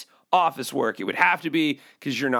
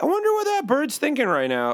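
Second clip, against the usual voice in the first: high, musing viewer voice; curious viewer; 10 semitones higher than usual. Retold or imitated. Fan watching the bird videos, high musing voice. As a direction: ask it high like a wondering fan.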